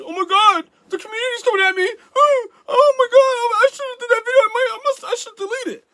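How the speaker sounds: high, whiny fake crying; mock panic. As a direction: wail like a panicked crybaby. Mocks a scared, backing-down self.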